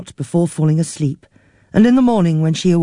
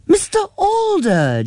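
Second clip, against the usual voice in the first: high, slow, bright phone greeting; a touch of forced cheer on the phone; cheerful greeting. A narrator's reading. Niece answers the care home manager cheerfully. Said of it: "Jean responded cheerfully".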